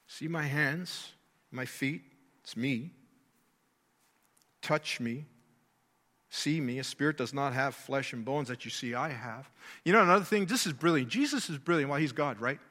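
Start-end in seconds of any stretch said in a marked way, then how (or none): 0.1-2.9 softer and lower, slow, See my hands almost murmured; tender quiet quote. Acted. Jesus quoted, intimate retelling. 4.6-9.5 softer, lower, gently spoken; tender quiet quote. Acted. Jesus quoted, intimate retelling.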